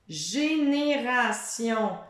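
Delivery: génération said slowly, each syllable drawn out; teacher modelling a word slowly; slow careful word. Shown as a demonstration. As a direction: spell out génération by sound, slowly.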